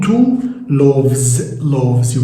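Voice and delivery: slow, careful spelling-out of "loaves"; slow teaching demo. Shown as a demonstration. Teacher to viewers showing the plural, said slowly. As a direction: say "two loaves" slowly with a long clear o. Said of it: "teacher models plural pronunciation".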